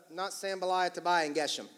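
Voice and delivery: ordinary delivery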